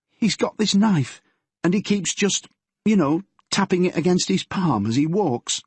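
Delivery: put-on nervous girl confessing to her father; teenage daughter Yvonne.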